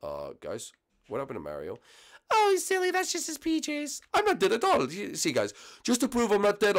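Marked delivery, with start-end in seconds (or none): 2.3-6.8 high squeaky puppet voice; high cartoon voice. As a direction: play a cheerful squeaky puppet. Mario puppet protesting he lives.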